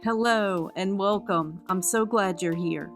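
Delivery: usual speaking voice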